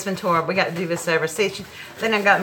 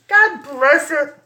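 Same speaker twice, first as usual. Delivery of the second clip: starting to cry; 6 semitones higher, 6 dB louder, slower than usual. Real, not acted. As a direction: voice rising and tearing up, slowing on god bless it. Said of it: "so I'm gonna cry now".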